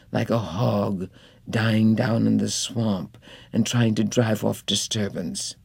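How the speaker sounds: ordinary delivery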